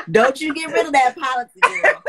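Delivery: loud laughing warning; laughing exclamation. Real, not acted. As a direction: shout it while cracking up. Laughing urgent advice.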